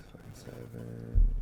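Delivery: unvoiced muttered aside; quiet whispered grumble. Real, not acted. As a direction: breathe it under your breath. Muttering to himself.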